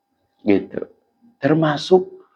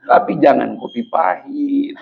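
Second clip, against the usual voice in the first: usual speaking voice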